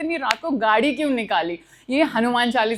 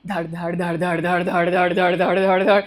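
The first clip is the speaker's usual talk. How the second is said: imitated rattling; 7 semitones lower than usual. Imitating car noise at speed.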